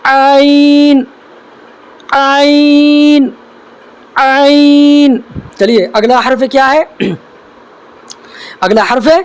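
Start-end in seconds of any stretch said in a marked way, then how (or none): 0.0-5.2 عین stretched very slowly from the middle of the throat; deliberate, drawn-out عین; drawn-out letter demo. Shown as a demonstration. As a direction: model عین long and slow for students. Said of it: "دیکھیے".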